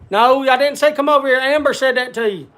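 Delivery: loud and high, telling the dog off; loud firm voice to the dog; calling off the dog. Real, not acted. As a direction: stop the dog coming over, firmly. Scolding the dog that came over.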